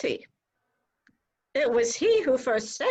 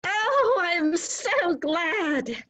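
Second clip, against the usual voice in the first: delighted; 6 semitones higher, much slower than usual. Acted. Hears of engagement.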